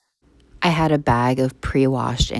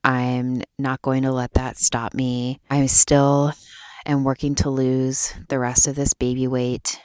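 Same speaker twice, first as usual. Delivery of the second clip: ordinary talk, nothing marked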